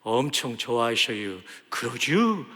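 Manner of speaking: mild, easygoing Chungcheong dialect. Retold or imitated. Preacher joking how Chungcheong people would say it, mild Chungcheong accent. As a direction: lightly put on a Chungcheong accent. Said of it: "충청도 말로 하면".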